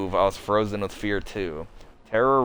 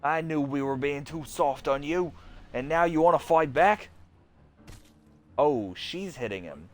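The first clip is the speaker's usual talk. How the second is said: raging teacher; 4 semitones higher than usual. Acted. Bitten teacher raging at Miss Park.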